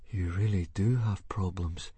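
lightly amazed remark; mildly awestruck; awed. Acted. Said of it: "he said in an awed voice".

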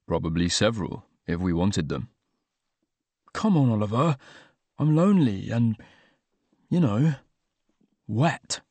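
reserved Oliver. Acted. Oliver shyly deflecting Luke's bath invitation, low and hesitant. Oliver voiced lower throughout.

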